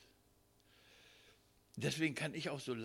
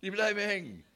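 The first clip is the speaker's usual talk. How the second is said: full-force preaching; 11 semitones higher, 12 dB louder than usual. Real, not acted. Preacher calling out to his dear ones, loud fervent preaching. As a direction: drive it home to the congregation.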